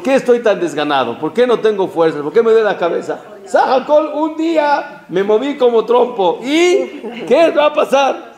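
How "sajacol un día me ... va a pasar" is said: laughing. Retold or imitated. Laughing through a retold hangover.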